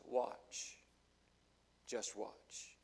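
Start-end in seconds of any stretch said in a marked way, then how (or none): 1.9-2.8 low unvoiced whisper; whispered warning. Real, not acted. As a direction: drop to a hushed warning. Repeated softly as whisper.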